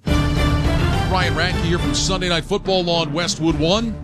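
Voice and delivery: normal talking voice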